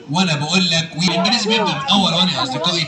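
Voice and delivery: very low puppet character voice; Aragoz puppet. Acted. Puppet lecturing his young friends on English, Aragoz voice. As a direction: argue as a puppet, far below your own pitch. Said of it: "الأراجوز ده وهو بيرغي".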